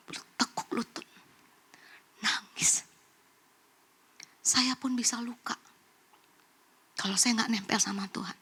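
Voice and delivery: near-whisper, slow with long pauses; hushed, wounded confession. Retold or imitated. Preacher confesses breakdown to congregation, whispered and sorrowful. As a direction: whisper it as if reliving the tears. Preacher recounts weeping and kneeling.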